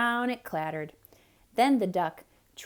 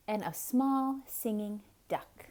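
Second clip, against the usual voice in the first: usual speaking voice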